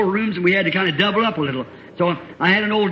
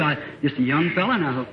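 usual speaking voice

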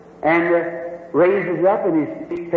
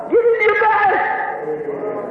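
preaching at full force; 9 semitones higher, much slower than usual. Acted. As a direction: drive it home to the congregation. Preacher exhorting congregation.

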